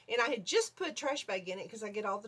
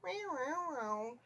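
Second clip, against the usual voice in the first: imitated cat calling, middle meow higher; 3 semitones higher, 4 dB quieter, slower than usual. Fidget telling her she caught a mouse, imitated meows. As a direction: meow like a cat announcing something. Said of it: "And I heard this".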